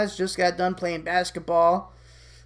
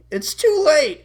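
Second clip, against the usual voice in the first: loud, higher, dismissive devil voice; shoulder devil; 9 semitones higher, 7 dB louder than usual. Acted. Devil telling Eddie there's no fixing it, loud scorn. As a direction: cut in like a scornful little devil. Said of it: "Devil Eddie".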